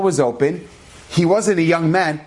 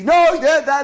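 much higher and louder, shrill retort; mock screaming back; 11 semitones higher, 4 dB louder, slower than usual. Wife screaming back at her husband in a fight, much higher and louder. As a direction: shout him down. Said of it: "they started yelling and screaming".

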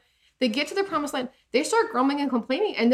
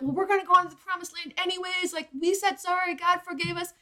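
higher, put-on bratty voice; mock whiny Israelites; 7 semitones higher, 3 dB quieter than usual. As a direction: sneer it like spoiled kids. Mocking the Israelites' excuse.

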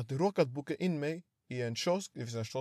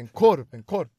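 much louder, emphatic repeat; loud imitated repeat; 2 semitones higher, 12 dB louder than usual. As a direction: punch it out for someone who didn't hear. Boy repeats after not heard. (högt och tydligt härmat)

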